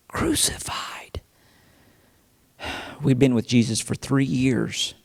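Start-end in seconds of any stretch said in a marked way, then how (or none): 0.1-1.2 slow, hushed whisper of disbelief; barely voiced, drawn-out horror; horrified hush. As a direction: let the word fall to a stunned whisper. Stunned echo of the sentence.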